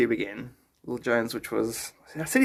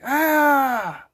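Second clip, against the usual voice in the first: mock ah; 11 semitones higher, 5 dB louder than usual. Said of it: "I'm like".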